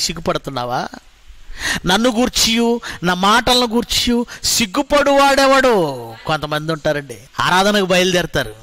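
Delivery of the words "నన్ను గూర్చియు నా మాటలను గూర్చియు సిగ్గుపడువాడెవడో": slow, raised recitation; emphatic verse reading. Acted. Preacher quoting Jesus to the congregation. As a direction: challenge them with the verse.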